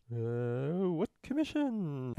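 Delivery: slow, drawn-out playing dumb; stall, then play dumb slowly; feigned ignorance. Madison declining Marbury's request, playing dumb.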